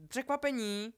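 high, loud, drawn-out "surprise!"; rozjařené překvapení. A narrator's reading. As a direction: call it out like springing a surprise. Albus jako Ron překvapí Hermionu. (hlasitě a vysoko, jako překvapení)

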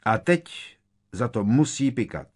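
loud, high demand for punishment; calling out. A narrator's reading. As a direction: call for payback loudly. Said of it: "zvolal vousatý kentaur".

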